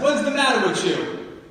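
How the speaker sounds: high, loud New York telling-off; mom's New York accent, loud and high. Retold or imitated. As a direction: demand it like an exasperated New York mom. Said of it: "In a New York accent".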